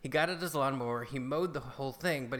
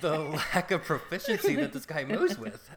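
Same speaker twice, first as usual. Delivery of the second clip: laughing; 4 semitones higher, 5 dB louder than usual. Real, not acted. Laughing at neighbor's mowing.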